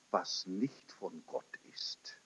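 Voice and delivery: whisper. Retold or imitated. Confession voiced as a whisper.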